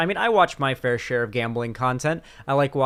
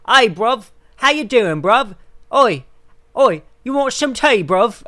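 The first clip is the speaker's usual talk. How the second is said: hammed-up London accent, much higher and louder; mock London accent; 8 semitones higher, 7 dB louder than usual. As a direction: play a cartoon London lad offering tea in a London accent. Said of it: "the London British accent that sounds like".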